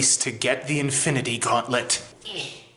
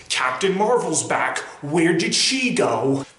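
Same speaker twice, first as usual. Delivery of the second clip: ordinary talk, nothing marked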